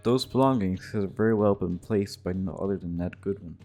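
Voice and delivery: ordinary talk, nothing marked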